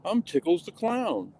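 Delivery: lightly voiced creepy clown. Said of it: "sounds like a creepy clown".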